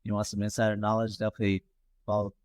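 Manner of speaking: ordinary talk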